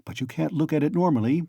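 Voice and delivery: ordinary delivery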